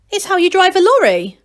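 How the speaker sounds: excited gnome; eager answer. Acted. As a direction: blurt it out eagerly. Angelo answers proudly.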